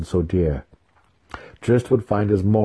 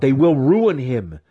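louder, higher, slow warning; angry growl; 7 semitones higher, 5 dB louder than usual. A narrator's reading. Weapon master protesting to Matron. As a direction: push it out hot and heavy. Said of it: "he growled".

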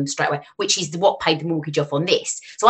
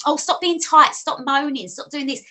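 mocking nag; 10 semitones higher than usual. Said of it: "I've even had comments like".

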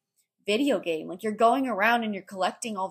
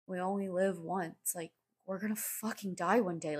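gloomy other side; 9 dB quieter than usual. Acted. Meme's other side facing death, gloomy. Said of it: "And then on the other side".

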